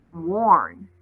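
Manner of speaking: ordinary delivery, nothing marked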